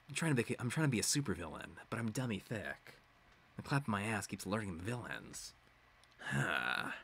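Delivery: low, quiet soldier growl; deadpan gruff parody; Snake voice. Pretend. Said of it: "I have to do the Snake voice".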